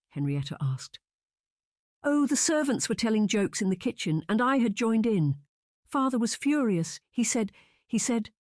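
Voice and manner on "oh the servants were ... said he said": high, upset telling, breaking off; near tears. A narrator's reading. Sister recounts father's fury, high and near tears. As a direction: retell it on the verge of sobbing. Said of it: "She dissolved into sobs again".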